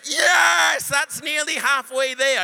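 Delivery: loud, glad rising cheer; excited cheer. Retold or imitated. Preacher cheers the healing progress, loud and glad. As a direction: whoop at the good news.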